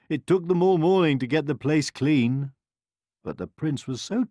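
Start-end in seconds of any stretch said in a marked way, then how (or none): none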